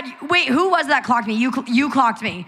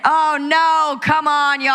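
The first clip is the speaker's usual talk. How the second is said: higher, playful performer's call; rally the crowd, pitched up; stage patter; 6 semitones higher, slower than usual. Retold or imitated. Guest re-enacts talking to the audience mid-show, high stage patter. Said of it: "And I say".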